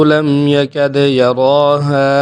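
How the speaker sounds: slow, loud melodic tajweed chanting; Quran recitation. Shown as a demonstration. Teacher recites the verse for students, slow melodic chant. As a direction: recite the verse in long drawn-out tones. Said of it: "تو اس کی میں نے تلاوت کر کے آپ کو سنا دی ہے".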